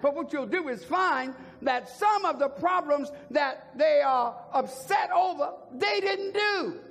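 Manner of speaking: high, ringing pulpit proclamation; full-force preaching. Real, not acted. Preacher driving the count home to the congregation, high and forceful. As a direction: hammer each repeated word, high then falling.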